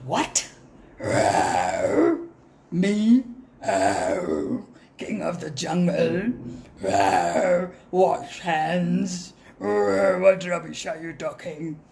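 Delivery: low, offended lion roar; indignant lion. Acted. Lion king offended at being told to wash hands, indignant. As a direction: scoff at the very idea. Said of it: "So the lion said".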